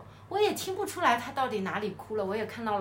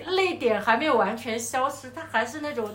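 normal talking voice

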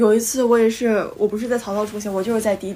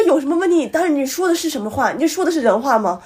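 higher, scornful echo of his words; incredulous mockery; 5 semitones higher than usual. Mocking the driver's excuse back at him, high and scornful. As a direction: throw his line back in disbelief.

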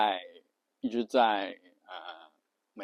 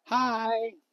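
flirty text voice; 12 semitones higher than usual. Retold or imitated. Message text read by woman.

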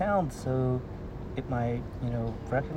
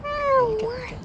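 high excited voice. Real, not acted. Cat approaching.

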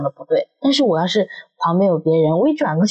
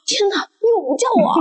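hurt complaint; 13 semitones higher than usual. Retold or imitated. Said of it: "然后我就说".

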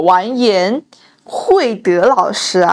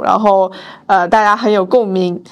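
ordinary talk

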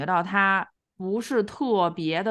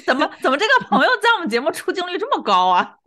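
high laughing protest; laughing disbelief; 10 semitones higher, 7 dB louder than usual. Real, not acted. Laughing incredulous remark.

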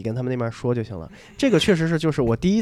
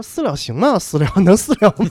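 higher, louder, hopeful double-checking; eager disbelief; 6 semitones higher, 7 dB louder than usual. Retold or imitated. To the other driver, eagerly asking about settling privately. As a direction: ask twice, barely believing the luck.

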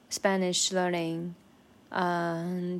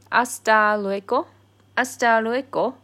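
louder, bright hasta luego; cheery goodbye; 3 semitones higher, 7 dB louder than usual. Shown as a demonstration. Saying goodbye between friends, louder and cheery. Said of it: "they usually use this".

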